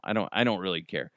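ordinary talk, nothing marked

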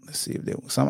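whispered to himself; whispering under his breath while checking stats. Real, not acted. Muttering while searching.

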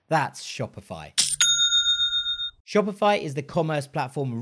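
polished, measured podcast sponsor read; scripted host-read commercial. Acted. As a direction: sell it clearly, landing each key phrase.

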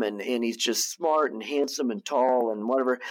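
ordinary talk, nothing marked

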